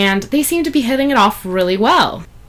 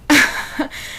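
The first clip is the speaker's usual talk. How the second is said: breathy laugh; 8 semitones higher than usual. Real, not acted. Laughs at own joke.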